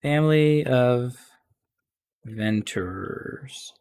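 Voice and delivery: very slow, drawn-out words with long pauses; composing while writing. Real, not acted. Game master wording the Longstocking lore, slow composing aloud. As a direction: sound out each word slowly while writing.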